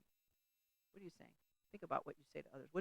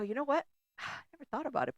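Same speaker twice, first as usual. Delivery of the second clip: sudden realization; 10 semitones higher, 5 dB louder than usual. Retold or imitated. Realizing coworker was right.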